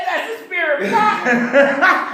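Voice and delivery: high, giggling through the punchline; laughing retelling. Real, not acted. Host laughing while repeating the caller's punchline.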